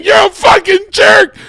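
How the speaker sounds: high, loud mock screech; angry yelling back. Wife answers her husband's insult, angry yelling back. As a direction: scream the insult back, full force. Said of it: "we're just yelling at each other".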